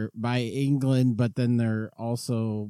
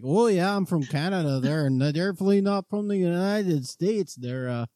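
lightly put-on Canadian accent; chirpy mock Canadian accent; 6 semitones higher than usual. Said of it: "the Canadian accent thing".

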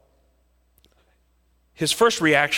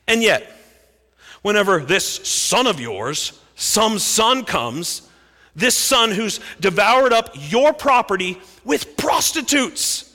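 loud, high, slow and bitter; bitter accusation; 6 semitones higher, 4 dB louder, slower than usual. Acted. Older son accusing father over the prodigal's feast. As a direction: spit out the unfairness. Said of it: "He's angry".

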